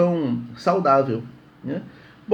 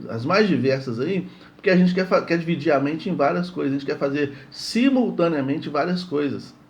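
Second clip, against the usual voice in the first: ordinary talk, nothing marked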